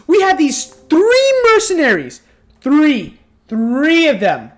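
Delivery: "three" punched high and loud; stress on three. Real, not acted. Vlogger mocking the chase scene, leaning hard on "Three". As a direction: hammer the word "Three" to mock the odds.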